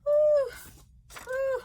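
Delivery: two loud, high blown-out whews; composing "whew". Real, not acted. Vlogger recovering from tears, steadying herself. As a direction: blow out hard to hold back tears.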